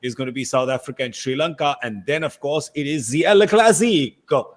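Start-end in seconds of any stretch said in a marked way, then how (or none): 2.8-4.5 El Clasico in a hammed-up Italian-Spanish accent, the El stretched and loud; mock Italian-Spanish accent. Host hamming up the England-Australia match in a mock Italian-Spanish accent. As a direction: announce "El Clasico" like a playful Italian-Spanish commentator. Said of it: "I don't know why I spoke Spanish with an Italian accent".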